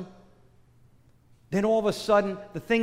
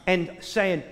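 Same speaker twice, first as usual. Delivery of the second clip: ordinary talk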